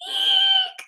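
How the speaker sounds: shrill high shriek; squeaky scream. A narrator's reading. Old people shrieking at the wolf, very high squeal. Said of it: "they screamed".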